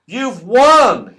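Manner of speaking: loud, cheery call; loud announcement. Acted. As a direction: tell him loudly he won. Moonface announcing the race winner.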